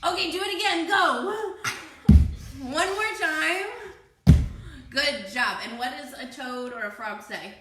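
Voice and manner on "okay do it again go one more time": high, loud cheer-them-on call; rally the jumpers, pitched up and loud. Real, not acted. Teacher urging kids to jump once more, calling out loudly.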